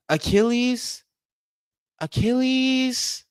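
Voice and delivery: high, stunned question; shocked disbelief. Real, not acted. Streamer hearing the injury is an Achilles, high shocked question. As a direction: voice leaps up, drawing out the word in disbelief.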